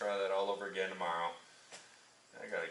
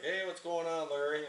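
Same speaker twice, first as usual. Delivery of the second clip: cheery greeting; 8 semitones higher than usual. Retold or imitated. Tire guy answering the phone.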